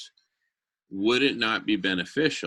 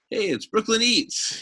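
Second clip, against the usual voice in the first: high, bright cartoon mascot greeting; 12 semitones higher than usual. Pitching a mascot to the meeting, high cartoon hello. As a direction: say it like a cheerful cartoon character.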